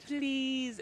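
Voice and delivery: tiny high pleading voice; little girl pleading. Retold or imitated. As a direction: beg sweetly like a small child. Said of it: "She goes".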